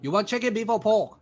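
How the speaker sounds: high, singsong waiter voice; playful high-pitched server; high mock waiter voice. Mock sushi chef question.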